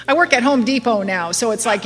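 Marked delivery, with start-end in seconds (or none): none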